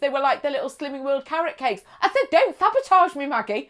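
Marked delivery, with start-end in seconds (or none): 2.3-3.7 lightly hammed-up plea, high and loud; mock protest. Dieter refuses cake from slimming friend, mock protest. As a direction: fend her off in mock alarm.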